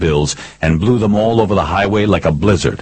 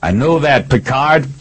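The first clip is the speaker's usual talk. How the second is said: raised, high-pitched shout; yelling back; 8 semitones higher than usual. A narrator's reading. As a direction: call it back across a standoff. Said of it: "I yelled back".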